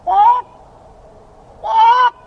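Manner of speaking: very high, loud walk walk walk call; owl call imitation. Hunter mimicking the owl call Bob heard, loud high calls. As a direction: call it out like a night bird. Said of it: "he said".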